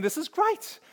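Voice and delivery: sudden high lift of delight on "great". Retold or imitated. To himself seeing the bus already waiting, high delighted lift. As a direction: pitch it up high like good news just landed.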